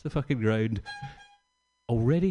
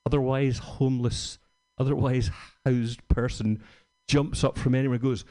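usual speaking voice

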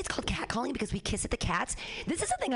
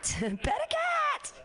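high laughing squeal; much slower than usual. Acted.

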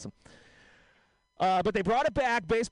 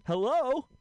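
higher, lightly voiced phone hello; answering the phone; 8 semitones higher than usual. Bill answering a collect call, a hint of an old voice. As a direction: pick up the phone like an old man. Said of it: "I'll do a quick impression".

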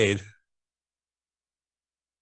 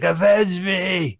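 overacted death scene; 7 semitones higher, 4 dB louder, much slower than usual. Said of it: "He's like".

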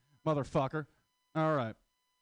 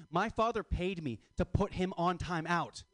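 normal talking voice

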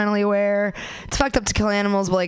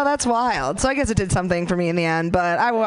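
ordinary talk

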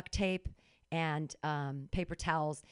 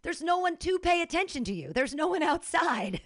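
excited exclamation; 9 semitones higher, 4 dB louder than usual. Real, not acted. Joking exaggeration to friend.

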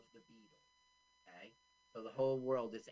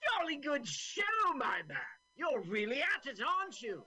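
very high, loud posh English voice; hammed-up jolly old colonel; pompous sputtering colonel; 14 semitones higher, 4 dB louder than usual. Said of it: "the greatest thing about this movie, is their accents".

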